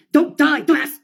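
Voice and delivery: loud, high mock yell; acted exasperated shout; exasperated scolding. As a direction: yell it like scolding a foolish friend. Acted scolding of suicidal friend.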